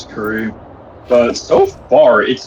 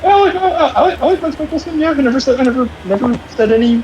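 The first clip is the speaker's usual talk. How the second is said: high-pitched nervous laughing denial; flustered high denial; 9 semitones higher, faster than usual. Pretend. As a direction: squeak it out, caught red-handed. Flustered denial of badmouthing Mads.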